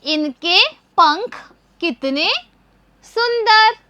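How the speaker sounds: slow, word by word; slow read-aloud. Shown as a demonstration. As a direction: read it slowly for the class. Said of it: "अब इसको हम एक साथ पढ़ेंगे".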